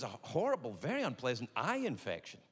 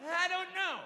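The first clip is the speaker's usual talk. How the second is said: whiny; 14 semitones higher, slower than usual. Retold or imitated. Pitiful patient answers doctor.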